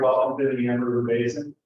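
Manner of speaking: usual speaking voice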